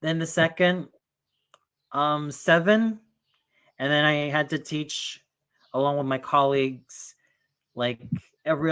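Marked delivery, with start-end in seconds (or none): none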